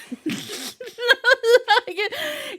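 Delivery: high, loud burst of real laughter. Real, not acted. Reader cracks up at the spread, bursting laugh. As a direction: crack up at the cards.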